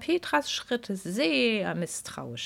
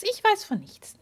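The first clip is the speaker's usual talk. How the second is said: even higher, teasing fairy; higher coy denial; 13 semitones higher, 3 dB louder than usual. Acted. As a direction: push the innocence further up.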